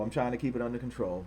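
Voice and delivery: calm yourself down under your breath; quiet repeat. Real, not acted.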